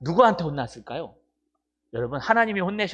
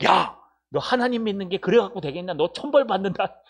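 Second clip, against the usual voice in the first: raised-pitch mock telling-off; mock scolding; 4 semitones higher, 4 dB louder than usual. Unbelieving husband rebukes his churchgoing wife, scolding. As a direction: play the indignant accuser. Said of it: "믿는 사람이 그러면 안 된다고".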